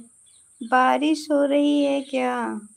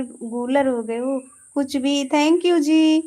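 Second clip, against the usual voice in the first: ordinary talk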